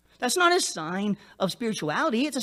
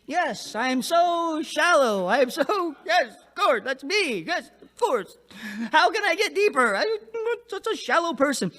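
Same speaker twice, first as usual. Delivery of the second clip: high, hammed-up eager voice; sing-song mock enthusiasm, pitched high; mock shallow person; 7 semitones higher than usual. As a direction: play a gushing, self-proclaimed shallow believer. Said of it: "You don't get shallow people like".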